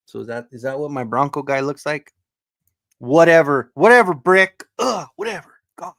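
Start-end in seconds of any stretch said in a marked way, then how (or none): 3.0-5.9 loud, rising exasperated brush-off; exasperated dismissal. Real, not acted. Streamer dismissing Brick's teasing, exasperated dismissal. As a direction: brush him off loudly, pitch climbing. Mock annoyance at teasing chat member.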